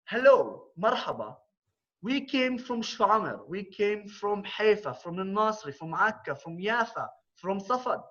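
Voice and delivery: speaker addressing an anti-Semitism protest as a rally speech. Retold or imitated. Opening his speech at a protest.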